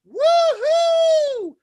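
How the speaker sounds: very high, loud falsetto whoop; lady at a street celebration whooping; shrill party whoop. Retold or imitated. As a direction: squeal it like a tipsy partygoer. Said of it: "a lady just go".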